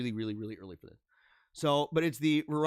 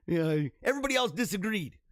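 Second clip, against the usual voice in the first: usual speaking voice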